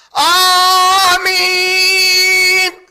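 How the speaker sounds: long, drawn-out, very loud amen; hammed-up shouted aamiin; mock screamed amen. Shown as a demonstration. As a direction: scream the amen to show the excess. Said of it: "ایسا چیخ کے نہیں بولتے،".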